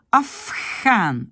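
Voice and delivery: Afghan with the R turned to an H sound; Dutch assimilation. Shown as a demonstration. Teacher demonstrates Afghan to students, R as H. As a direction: say Afghan as afxan, R becomes H. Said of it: "ça devient H".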